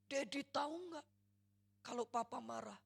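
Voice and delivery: pouring the hurt out again; mock daughter's angry outcry; angry outburst. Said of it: "Anak ini keluarkan lagi".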